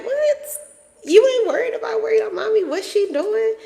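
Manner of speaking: very high, squeaky-pitched disbelief; mock incredulous. Mother when son is too cool about her absence, mock incredulous. Retells own reaction to ignoring son.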